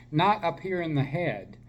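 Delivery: ordinary talk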